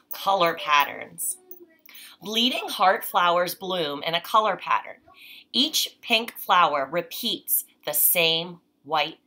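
clear, measured read-aloud voice; reading to children. Acted. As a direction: read the page to a child, unhurried. Reads picture book aloud.

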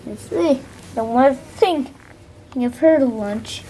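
ordinary delivery

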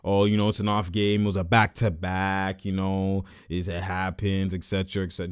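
low, soft recited excuses; dismissive excuses. Retold or imitated. Streamer parrots excuses for the loss, low and dismissive. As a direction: reel off excuses in a low murmur. Mimicking excuses for a bad game.

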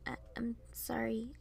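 very soft, whispery stammer; muttered apology. A narrator's reading. Piper apologizes to Varian after the quarrel, muttering. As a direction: mutter it almost under the breath. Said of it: "I muttered".

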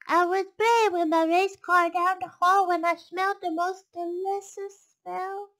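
put-on high, loud child voice; a little boy telling Omu. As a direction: sound like an eager small boy.

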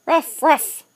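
loud puppy ruff ruff; playful dog bark. Acted. Parent barking for toddler.

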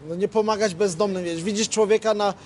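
ordinary talk, nothing marked

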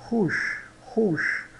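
"ruche" com R chiado e U fechado; French ruche. Shown as a demonstration. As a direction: diga "ruche" devagar. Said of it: "é uma colmeia". (modelo de "ruche" para repetir)

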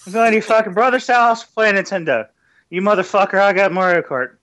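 put-on high, loud drunk uncle; imitating the old drunk. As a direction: play the rowdy drunk at the reunion. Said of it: "you just sounded like the old drunk at the family reunion".

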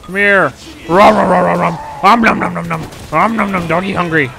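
loud, hammy dog chomping; hungry dog voice. Pretend. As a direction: gobble like a starving dog. Playing dog mode, eating noises.

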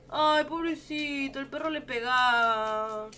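drawn-out, pitying whimper; slow, tender lament for a suffering animal; a punto de llorar. Real, not acted. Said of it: "me voy a poner a llorar". (lamento lastimero, casi llorando)

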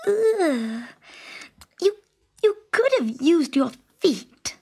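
high, squeamish princess voice; disgusted princess. A narrator's reading. Princess disgusted holding the muddy golden ball. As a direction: wrinkle your nose at the wet ball.

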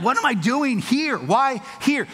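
high, loud, baffled questioning; exasperated disbelief. Retold or imitated. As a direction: wail it upward in mock dismay. Retold dismay at new place.

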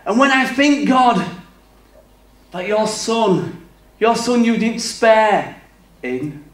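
imitated eloquent prayer. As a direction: mimic someone praying with great fervour. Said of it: "They continue".

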